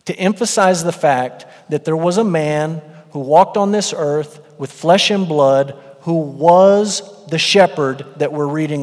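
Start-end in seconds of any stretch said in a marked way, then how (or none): none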